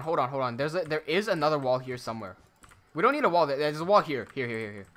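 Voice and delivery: ordinary talk, nothing marked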